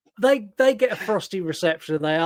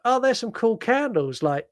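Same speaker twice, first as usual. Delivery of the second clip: tactful small talk, pitched up; mock delicate; 7 dB louder than usual. Imagining the co-host asking about candles, mock delicate. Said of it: "I think you'd be more delicate".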